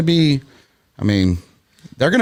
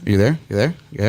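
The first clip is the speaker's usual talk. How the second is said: a hint of a smooth ladies' man; lightly crooned come-on, playful. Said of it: "Dude, like, ladies' man voice".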